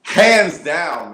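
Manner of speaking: a big, loud cheer of approval; booming approval. Real, not acted. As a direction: shout it out in agreement. Host cheering the guest's advice.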